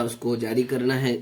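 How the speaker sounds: ordinary talk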